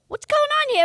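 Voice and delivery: high loud startled question; startled star. Retold or imitated. Star of the show startled by intruder, shouting. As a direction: demand it in shock. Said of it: "He goes".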